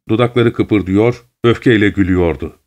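ordinary delivery

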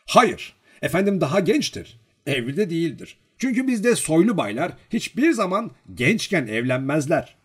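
high, emphatic explaining; self-important lecturing. A narrator's reading. Servant explaining noble customs to the girl, pompously instructive. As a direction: pronounce it like a weighty lesson. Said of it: "önemli bir şey öğretiyormuş gibi".

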